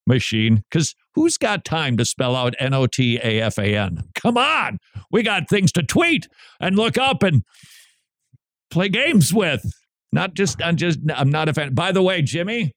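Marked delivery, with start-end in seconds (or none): 4.1-9.6 high, exasperated plea; mock impatience. Host parodying kids too busy to spell, mock impatience. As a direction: play the too-busy texter.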